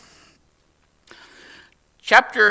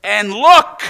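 "look" said loud with a rise; emphatic call to look; 4 semitones higher, 8 dB louder than usual. Shown as a demonstration. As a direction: hit "look" hard and lift it. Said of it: "it's very emphatic".

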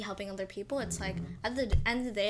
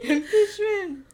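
higher, louder, slow and dramatic; dramatic drawn-out; 9 semitones higher, 8 dB louder, slower than usual. Pretend. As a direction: stretch it out like a verdict. Repeating the punchline dramatically.

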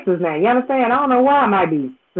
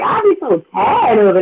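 usual speaking voice

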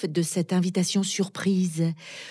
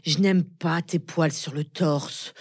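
ordinary talk

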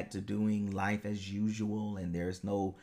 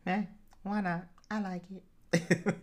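playful squeal; 12 semitones higher than usual. Real, not acted. Playful answer to podcast king.

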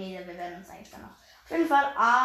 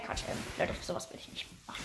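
whispering; 5 semitones lower, 11 dB quieter than usual. Real, not acted. Taking gift card joke.

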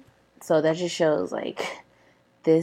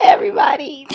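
laughing; 10 semitones higher, 9 dB louder, faster than usual. Real, not acted. Laughing exclamation.

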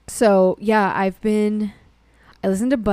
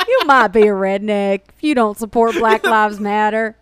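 Jeff Foxworthy impression; 4 dB louder than usual. Mocking Ammon Bundy in a Southern comedian accent. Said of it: "like, Jeff Foxworthy".

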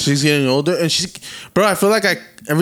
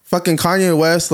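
ordinary delivery

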